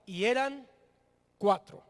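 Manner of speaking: pause, then land firmly on "cuatro"; stress on cuatro. Shown as a demonstration. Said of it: "enfatiza la palabra".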